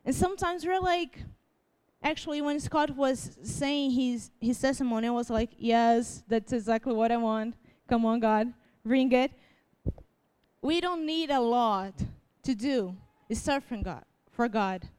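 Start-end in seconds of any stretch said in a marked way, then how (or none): none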